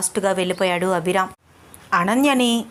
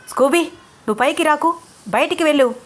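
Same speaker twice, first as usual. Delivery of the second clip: angry shout; 7 semitones higher, faster than usual. Acted. Abhiram angrily ordering the dog out, shouting. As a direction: bark the dog out of the room. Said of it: "అని గట్టిగా అరిచాడు".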